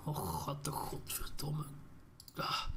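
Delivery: muttered swear under breath; hushed disappointed curse; whispered curse. Real, not acted. Missed spawn, swears under breath.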